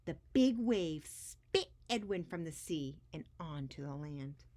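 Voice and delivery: put-on lively read-aloud voice for children; expressive storybook reading; story narration. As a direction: read it like a picture book to a class. Reads picture book aloud to kids.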